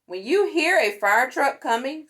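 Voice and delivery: plain reading voice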